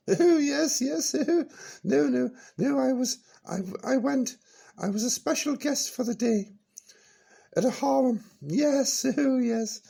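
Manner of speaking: put-on high, bright-eyed old dear reminiscing; high character voice Peachy. Old character reminiscing to the radio host, high voice. Peachy telling harem story, giggling.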